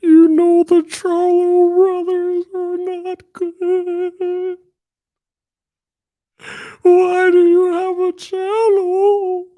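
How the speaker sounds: slow, piercingly high mock wail; Paul Bearer. Streamer mimicking hostile Charlo critics, high drawn-out cry. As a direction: cry it out high, stretching every word. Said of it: "these Paul Bearer cries".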